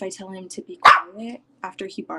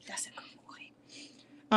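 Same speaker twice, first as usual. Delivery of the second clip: whisper; 11 dB quieter, much slower than usual. Real, not acted. Praising dog softly.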